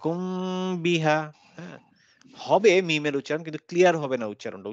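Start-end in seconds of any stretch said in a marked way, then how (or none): 0.0-1.2 কুমবিহা drawn out very slowly with the মিম hummed in the nose; slow nasal ikhfa. Shown as a demonstration. Teacher answering a student's question, slow nasal demonstration. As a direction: drag it out and let the মিম hum like গুং গুং. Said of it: "গুং গুং গুং গুং করতে থাকবে".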